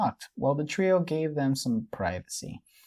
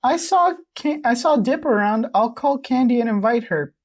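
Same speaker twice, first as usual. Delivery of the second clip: put-on high, louder girlish character read; Mabel voice; 8 semitones higher, 5 dB louder than usual. Mabel asking Pacifica to buy Candy a ticket, high character voice. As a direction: pitch it up high as an eager Mabel. Reads Mabel's lines in fan fiction.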